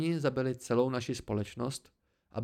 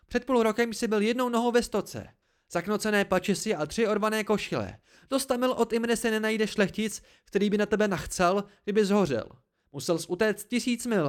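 high, loud, scathing attack; furious tirade; 9 semitones higher, 4 dB louder than usual. A narrator's reading. Dena throws his poverty at him, furious tirade. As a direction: tear into them, pitched high.

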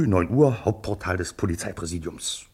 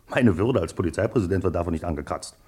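ordinary talk

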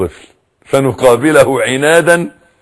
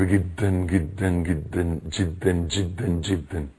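much softer and lower, each جدًا fading further; fading repetition; 8 semitones lower, 13 dB quieter, slower than usual. Real, not acted. Speaker telling parents how hard these times are, fading softly. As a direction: let the words die away in weary emphasis.

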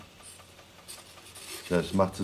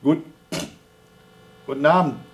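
astronaut radio; 7 semitones higher, 7 dB louder than usual. Acted. Astronaut answering Houston by radio.

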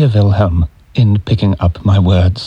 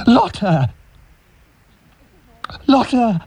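anguished cry; 11 semitones higher than usual. Acted. Crying out her name.

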